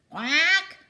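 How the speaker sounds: loud, high duck quack; startled quack. Acted. Missus Mallard quacking as she tumbles back. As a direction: squawk like a startled mother duck. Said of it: "went Missus Mallard as she tumbled back again".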